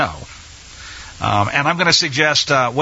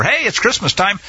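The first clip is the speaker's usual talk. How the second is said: normal talking voice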